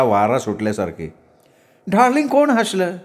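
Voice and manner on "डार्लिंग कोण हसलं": high woman's voice; questioning sweetheart. A narrator's reading. Woman asking her darling who laughed, high voice. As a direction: ask in a high put-on pitch.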